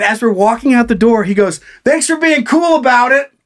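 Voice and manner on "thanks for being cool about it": hammed-up smug parting shot; smug bartender. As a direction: toss it off with fake friendliness. Bartender voiced, smug parting line.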